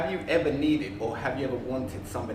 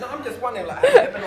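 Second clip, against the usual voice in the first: laughing; 8 semitones higher, 10 dB louder, faster than usual. Real, not acted. Laughing while asking question.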